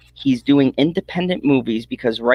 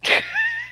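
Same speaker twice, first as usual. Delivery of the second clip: squealing high laugh; 4 dB quieter than usual. Real, not acted. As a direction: let out a shrill giggle. Laughing at joke.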